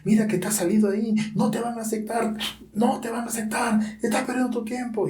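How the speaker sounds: mock the listener like a sneering tempter; lightly voiced devil, rising into a high taunt; the devil's voice. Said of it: "Esa voz maligna, esa voz feroz, esa voz horrible".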